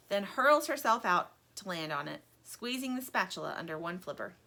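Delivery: plain reading voice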